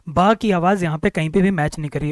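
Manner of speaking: usual speaking voice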